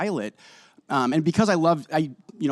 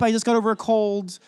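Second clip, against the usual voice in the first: normal talking voice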